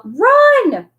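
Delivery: high, loud urgent yell. A narrator's reading. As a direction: shout to get everyone moving.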